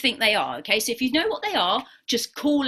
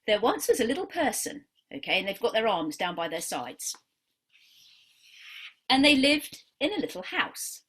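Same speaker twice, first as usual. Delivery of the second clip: story narration; 3 semitones lower, 4 dB quieter than usual. Acted. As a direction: tell it gently, like a picture story. Tells the dog-drawing story.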